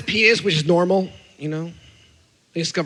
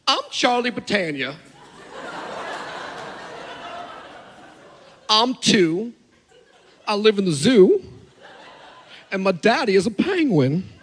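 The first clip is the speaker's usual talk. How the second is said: imitating a three-year-old with a Southern twang, lilting; toddler's alter ego; 3 semitones higher than usual. Toddler announcing his alter ego to Dad, lilting put-on voice. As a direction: introduce yourself as a little boy's invented character. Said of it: "He has an alter ego".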